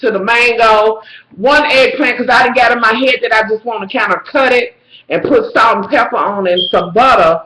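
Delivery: normal talking voice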